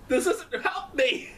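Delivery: laughing slow words. Real, not acted. Fan laughing at the match ending, laughing. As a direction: crack up through the words.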